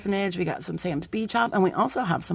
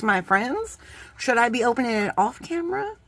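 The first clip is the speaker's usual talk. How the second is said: ordinary talk, nothing marked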